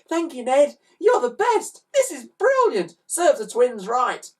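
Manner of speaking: put-on high, loud delighted girl; a thrilled child; frizzy-haired girl. A narrator's reading. Girl thanks Ned.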